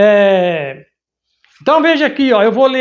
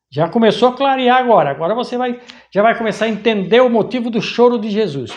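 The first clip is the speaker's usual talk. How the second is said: normal talking voice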